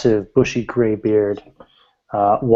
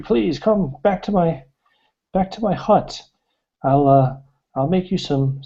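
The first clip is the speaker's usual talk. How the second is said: put-on higher, fussing old hermit voice, pleading at first; worried old woodcutter; 3 semitones higher than usual. Game master voicing the hermit warning the warrior off, high fretful old voice. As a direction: urge them off the mountain like a fretful old man. Old man warns and invites.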